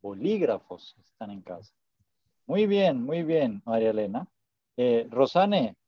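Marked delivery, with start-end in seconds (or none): 0.0-1.7 "Bolígrafos" stressed on the second syllable, bo-LÍ-grafos. Shown as a demonstration. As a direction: lean on the LÍ of "Bolígrafos". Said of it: "El acento lo tiene en la I".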